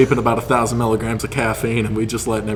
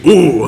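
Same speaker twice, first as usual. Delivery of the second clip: strained ooh; 6 semitones higher, 8 dB louder than usual. Retold or imitated. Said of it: "and he's like".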